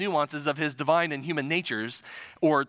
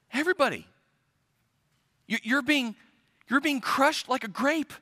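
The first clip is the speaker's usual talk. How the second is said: higher-pitched, incredulous protest; exasperated desperation; 7 semitones higher than usual. Retold or imitated. Peter protesting to Jesus about the crowd, exasperated. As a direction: protest the obvious with condescending disbelief. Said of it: "You hear the desperation in his voice".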